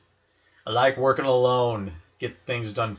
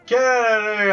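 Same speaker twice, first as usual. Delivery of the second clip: shout; 10 semitones higher, 5 dB louder than usual. Real, not acted. Calling out wrestler.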